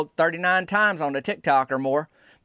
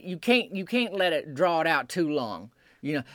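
usual speaking voice

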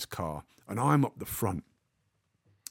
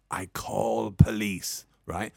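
put-on Russian accent; 4 semitones lower, slower than usual. Russian clamper threatens.

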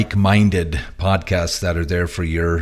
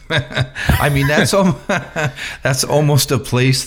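laughing; 7 semitones higher than usual. Real, not acted. Laughing mid-sentence at Pahrump.

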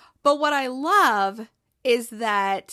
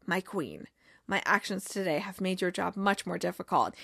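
low, hushed, solemn mock-prophet; grand soft Zava voice; 5 semitones lower, 7 dB quieter than usual. Star player solemnly greeting his new manager, low and hushed. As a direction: intone it like a self-serious guru.

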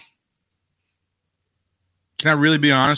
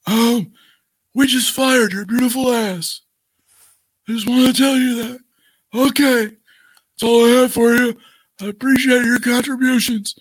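high, polite mock-corporate voice; professional guy; 9 semitones higher than usual. Executive politely firing a wrestler over the phone, smarmy. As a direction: fire her sweetly like a smarmy executive. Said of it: "Johnny Ace being the professional guy".

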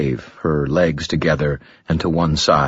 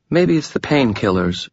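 lightly voiced woman, higher, smooth and composed; a hint of a calm, alluring woman, higher; Lashiel, gracious demon; 8 semitones higher than usual.

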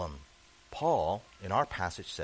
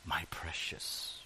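lower, softer, slow breathy whisper; Gollum whisper; 11 semitones lower, 12 dB quieter, slower than usual. Retold or imitated. Preacher quoting Gollum about the ring, creepy whisper. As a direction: hiss it like a creature clutching a treasure. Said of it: "what they called the ring".

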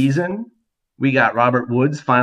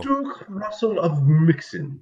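slow, higher, hammed-up English accent; 6 semitones higher, slower than usual. Shown as a demonstration. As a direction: put on a posh-ish British voice for the name. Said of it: "You will do it with English accent".

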